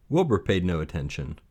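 ordinary delivery, nothing marked

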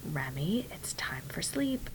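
soft, airy near-whisper; hushed bedtime voice. Retold or imitated. Mom settling Remy back down at bedtime, hushed. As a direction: soothe a baby to sleep in a whisper. Soothing child to sleep.

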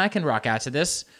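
ordinary delivery

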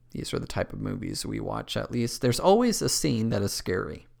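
ordinary delivery

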